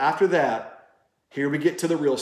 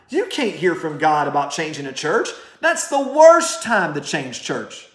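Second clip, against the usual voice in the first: higher-pitched, driving preacher's challenge; forceful preaching; 6 semitones higher, 4 dB louder than usual. Real, not acted. Preacher confronting bitter churchgoers about hearing from God, forceful and insistent. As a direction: press each question home with big pitch swings. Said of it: "When I preach like this".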